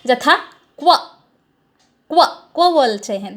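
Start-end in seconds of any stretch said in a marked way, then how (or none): 0.8-2.2 "क्व" short and tossed out sideways; svarita accent. Shown as a demonstration. Teacher demonstrating the mixed Vedic accent, tossed-out "क्व". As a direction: throw "क्व" away, neither up nor down. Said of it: "हम उसको स्वरित बोलते हैं".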